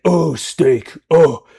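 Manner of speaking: loud macho meathead mockery; exaggerated, booming tough-guy impression; over-masculine guy. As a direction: bellow it like a hammed-up he-man. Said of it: "he, like, is over masculinized".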